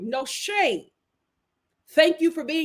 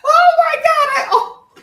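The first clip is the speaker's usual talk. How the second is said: loud, very high shriek of alarm; sudden high-pitched shock; real startled outburst; 13 semitones higher, 8 dB louder than usual. Real, not acted. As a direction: cry out, then lose the words. Startled while busy off-mic.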